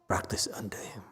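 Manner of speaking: whispered urging, almost no voice; hushed whisper to the monks. Real, not acted. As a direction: breathe it as a hushed, earnest plea. Teacher urging refuge with master.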